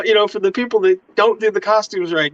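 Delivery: ordinary talk, nothing marked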